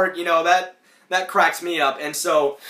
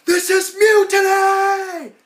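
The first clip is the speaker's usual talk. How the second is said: much higher, louder, slowed outraged cry; outraged shout; 9 dB louder, much slower than usual. Retold or imitated. Ed outraged at his teammates quitting, much higher shout. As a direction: bellow like a betrayed captain. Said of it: "And he's like".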